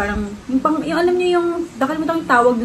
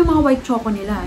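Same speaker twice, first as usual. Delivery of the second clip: talking with finger at lips; wiping lip while speaking; finger at mouth. Real, not acted. Seen 0: fingertip at lip corner while talking, chewing unclear.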